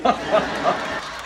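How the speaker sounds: amused burst of laughter; real laugh. Real, not acted. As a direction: laugh at the clip's gag. Reacts to played sitcom clip.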